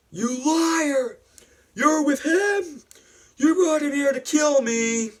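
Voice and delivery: high, loud hammed-up rage; shrieked theatrical accusations; acted furious accusation. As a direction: yell it like a betrayed lover. Acts out Anakin's furious accusation.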